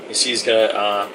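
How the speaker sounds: normal talking voice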